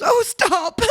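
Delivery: high, helpless "oh, stop"; mock a mum in fits of laughter; squealed protest through mimicked giggles; imitating mother laughing. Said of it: "That's how my mother laughs".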